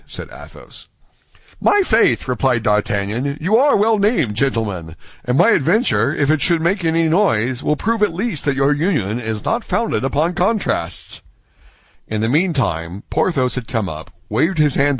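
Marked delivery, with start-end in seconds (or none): 1.6-2.3 lightly acted high young voice; young D'Artagnan. D'Artagnan marvelling at the three friends, lightly acted. 3.4-11.2 put-on high, lively young gentleman; young D'Artagnan. D'Artagnan complimenting the three inseparables, high and lively.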